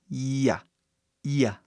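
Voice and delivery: "Ia" as a long ee and a very short ah; long I, quick A. Shown as a demonstration. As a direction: hold the I of "Ia", then a quick A. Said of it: "I comprido e um A super rápido". ("Ia" com I comprido e A super rápido)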